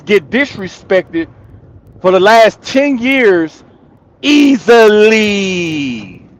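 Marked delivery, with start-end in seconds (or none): 4.2-6.3 "easily" stretched very slowly; long, lingering "easily" to hammer the point home; drawn-out emphasis. Real, not acted. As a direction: drag out every syllable of "easily". Drives home his point emphatically.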